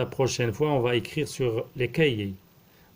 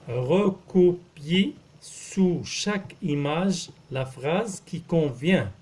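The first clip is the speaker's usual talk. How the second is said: slow dictation; 3 semitones higher, slower than usual. Shown as a demonstration. Teacher reading the exercise to pupils, slow dictation. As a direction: read it out syllable by syllable for beginners.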